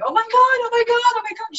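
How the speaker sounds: high, loud breathless squeal; woman outside Macy's chasing her, high excited squeal; imitating an excited fan. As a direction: gasp it out like a fan running up. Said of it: "started running towards me going".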